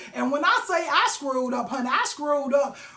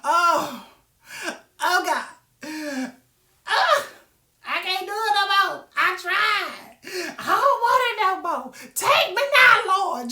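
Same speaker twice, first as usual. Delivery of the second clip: mock adult tantrum; 4 semitones higher, slower than usual. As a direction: whine like a grown-up throwing a fit. Mock tantrum of a failing person.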